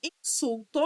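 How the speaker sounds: "Insulto" split as in-sul-to, full final O; syllable by syllable. Shown as a demonstration. Teacher checking syllable division, "Insulto" syllable by syllable. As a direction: sound out "Insulto" piece by piece. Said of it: "divisão silábica de todas as palavras".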